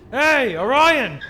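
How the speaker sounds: drawn-out shout of a name; calling out. Acted. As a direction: hail a friend from far off. Calling out across a distance.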